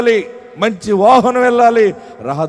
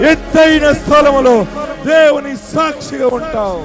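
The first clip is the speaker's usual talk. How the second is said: climactic proclamation; 4 semitones higher than usual. Real, not acted. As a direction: proclaim the blessings at full force. Sermon climax of blessings. (ఉచ్చస్థాయిలో గట్టిగా ప్రకటన)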